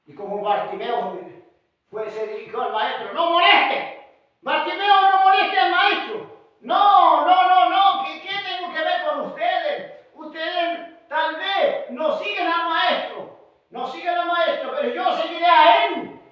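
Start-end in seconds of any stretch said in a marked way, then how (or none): 3.1-6.3 high, loud acted rebuke; loud scolding. Preacher voicing the crowd hushing Bartimeo, loud scolding. 6.6-16.2 high, loud, insistent refusal; defiant shouting. Acted. Preacher acting Bartimeo answering the crowd, defiant shout.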